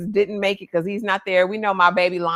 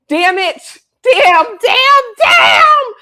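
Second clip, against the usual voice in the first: rising, louder, higher curses; frustrated shout; 11 dB louder, much slower than usual. Real, not acted. Furious at the show, shouting damn. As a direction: yell it out in frustration.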